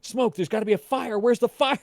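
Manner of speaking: high, loud panicked yelling about smoke; jolted awake, shouting in alarm. Retold or imitated. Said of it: "I shoot up in bed and go".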